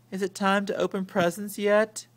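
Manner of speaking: yawned. A narrator's reading. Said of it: "yawned Cousin Elwood".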